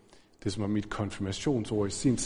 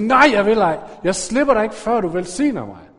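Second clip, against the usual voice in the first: loud, high, stubborn refusal; insistent shout; 8 semitones higher, 9 dB louder than usual. Acted. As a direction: yell it like wrestling and refusing to let go. Jakob kæmper med Gud. (højt, stædigt råb)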